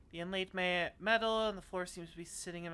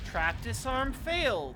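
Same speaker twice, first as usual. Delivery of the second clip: frustrated loud; 7 semitones higher, 9 dB louder, slower than usual. Real, not acted. Second failed disarm.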